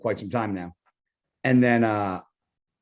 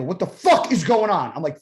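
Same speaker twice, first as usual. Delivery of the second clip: finally snapping; 7 semitones higher than usual. Retold or imitated. Bodybuilder snapping at his friend who won't give the bad news, angry yell. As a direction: snap and yell it, pitch jumping up. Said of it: "I finally snapped".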